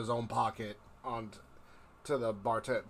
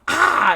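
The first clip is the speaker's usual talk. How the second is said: high, loud cry of "ah"; short high yell on a throw; 14 dB louder than usual. Acted. Kalani flinging glasses into the air, yelling. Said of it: "just throws the glasses up".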